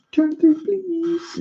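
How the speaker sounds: "Turn three, please" squeaked very high and slow; squeaky high voice. Pretend.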